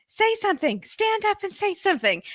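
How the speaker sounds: high-pitched imitation of urging someone on; mimicked pushy dad. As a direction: urge them in a high, put-on voice. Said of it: "Mister Ark is like".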